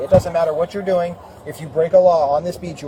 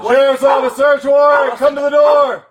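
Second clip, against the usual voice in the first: shouted police announcement; police pounding door; 7 semitones higher, 6 dB louder, slower than usual. Real, not acted. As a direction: yell it through the door, high and loud. Announcing search warrant at door.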